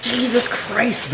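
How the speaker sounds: slow, high amazed exclamation; stunned by the size of it; surprised. Real, not acted. Huge briefcase of food unboxed.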